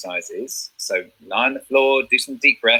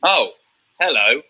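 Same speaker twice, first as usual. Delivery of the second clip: intimidating; 3 semitones higher, slower than usual. Shown as a demonstration. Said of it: "To intimidate".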